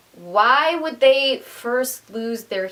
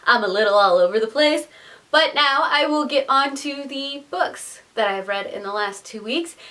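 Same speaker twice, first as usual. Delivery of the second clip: normal talking voice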